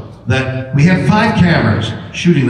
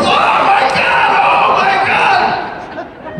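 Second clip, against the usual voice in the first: high, drawn-out mock shriek; panicked scream; much slower than usual. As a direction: wail it like a frightened kid. Terry panics at the fish.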